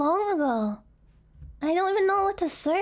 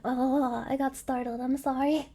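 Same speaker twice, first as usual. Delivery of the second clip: usual speaking voice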